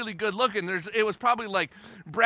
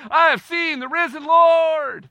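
higher, louder and slow, as if bursting with the news; excited proclamation; 9 semitones higher, 10 dB louder, slower than usual. Retold or imitated. Mary Magdalene telling the disciples, slow excited higher cry. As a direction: announce it like the best news of your life. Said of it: "She said".

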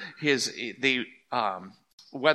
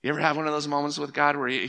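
ordinary talk

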